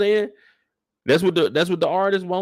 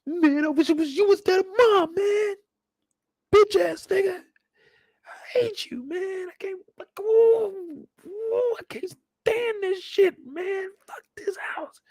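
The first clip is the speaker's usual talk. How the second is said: much higher, tearful mock sob; crying son; slower than usual. Son crying at his father, high mock sobbing. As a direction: wail it out like a heartbroken teen. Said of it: "Tear comes down".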